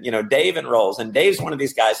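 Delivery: normal talking voice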